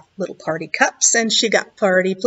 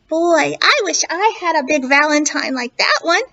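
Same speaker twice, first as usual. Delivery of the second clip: duck voice; 5 semitones higher than usual. A narrator's reading. Duck admiring mouse's party, higher amazed voice. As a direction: gush like an amazed little duck. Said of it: "Duck looked around and said".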